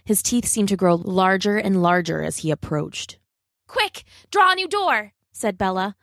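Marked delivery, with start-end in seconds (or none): 3.7-5.1 high-pitched urgent command; acted alarm. A narrator's reading. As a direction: urge your friend on, pitch raised. Bella urging Rob to escape.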